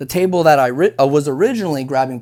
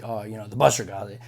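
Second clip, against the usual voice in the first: dismissive, lower and softer; arrogant; 8 semitones lower, 7 dB quieter than usual. Retold or imitated. Arrogant server leaving the work to the busser, lazy dismissal. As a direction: brush it off like it's beneath you. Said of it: "it's like an arrogance".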